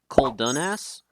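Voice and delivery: "Coldunas" as cole-DUN-ass, rising; Coldunas, questioning. Shown as a demonstration. Streamer asking how to pronounce it, tentative rising question. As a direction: say "Coldunas" with DUN, ending upward. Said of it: "how you pronounce it".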